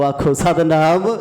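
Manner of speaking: loud call for the sangat to answer Satnam. Real, not acted. Preacher calls on the listening congregation, loud rising Satnam.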